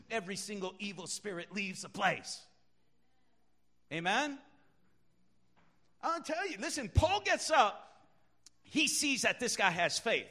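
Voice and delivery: normal talking voice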